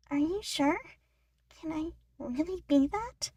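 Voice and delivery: lightly voiced chipmunk girl, doubtful and hesitant; Brittany, unsure. Brittany asks Ian if she can be a star, doubtful.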